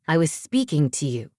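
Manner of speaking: low, sharp rebuke; sharp interruption. A narrator's reading. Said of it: "he cut in sharply".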